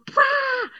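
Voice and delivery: sudden high yelp of alarm; alarmed pah. Retold or imitated. Reptilian brain hitting the alarm, high startled burst. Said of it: "And then the reptilian brain just goes".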